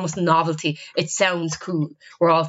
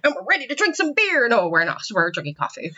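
normal talking voice

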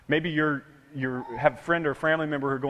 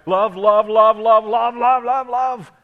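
love repeated, each one louder and higher; growing bigger; 5 semitones higher, 9 dB louder, slower than usual. Shown as a demonstration. Pastor shows the word love getting bigger, building loud. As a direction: let love swell up like a shout. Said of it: "made the word bigger and bigger and bigger".

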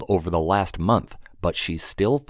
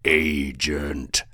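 low grating rumbling voice; grating rumble; 5 semitones lower than usual. Acted. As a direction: grate the word out low. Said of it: "grated Mullet".

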